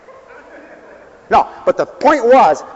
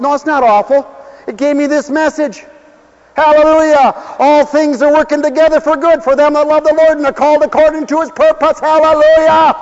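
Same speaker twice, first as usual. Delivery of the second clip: fervent preaching; 9 semitones higher, 5 dB louder than usual. Acted. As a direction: hammer the message home like a revival preacher. Preaching at full force.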